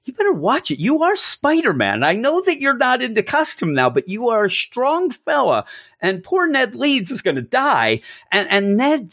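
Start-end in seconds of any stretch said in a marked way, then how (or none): 0.1-5.7 high, jokey warning to the hero; a lightly voiced worried fan; mock warning. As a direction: urge him to hold back in a hammed-up voice. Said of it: "And I'm thinking".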